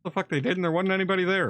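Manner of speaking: high, disbelieving scoff; incredulous outburst. Real, not acted. Scoffs at the claimed hits.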